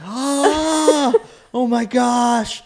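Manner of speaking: high shocked cry, half laughing; a high yelp of pain that turns into laughter. Retold or imitated. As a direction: yelp as honey hits the eyes. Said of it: "And I'm like".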